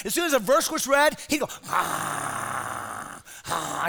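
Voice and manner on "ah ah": low, soft drowsy groan; mock snore. Imitating Bobby dozing off at the Bible reading, low sleepy groan. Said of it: "He'd just fall asleep".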